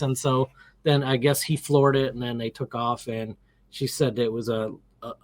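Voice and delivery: ordinary delivery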